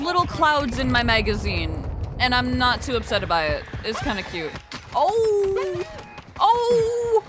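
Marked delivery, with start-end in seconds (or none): 4.9-7.2 urging a teammate on mid-fight; hyped yell at the game; excited. Real, not acted. Excited reaction during a fight.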